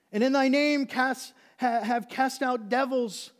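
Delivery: loud, high, frantic self-justifying; desperate cries, easing slightly at the end; impassioned building plea. Acted. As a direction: shout your case as if begging the judge. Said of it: "The passion is building".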